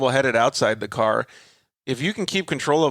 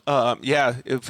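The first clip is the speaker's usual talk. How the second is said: normal talking voice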